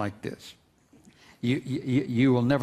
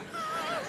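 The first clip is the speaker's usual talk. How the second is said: short breathy laugh; 8 dB quieter than usual. Real, not acted. Laughs at his own texting.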